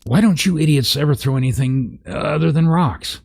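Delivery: high, exasperated giant yell; shrill angry giant. Acted. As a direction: scold the others in a high voice. Angry giant yells at others.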